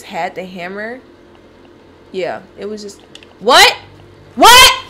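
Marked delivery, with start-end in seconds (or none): 3.4-4.8 high, loud startled questions; shocked what. Real, not acted. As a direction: blurt it out in disbelief. Shocked by spider in game.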